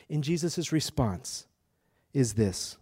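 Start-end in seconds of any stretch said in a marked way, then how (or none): none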